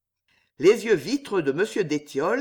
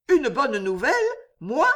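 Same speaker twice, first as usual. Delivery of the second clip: higher, startled questions; startled exclamation; 7 semitones higher than usual. A narrator's reading. Jeanne told she has good news, exclaiming in surprise. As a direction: exclaim it, rising in disbelief. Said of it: "s'écria Jeanne".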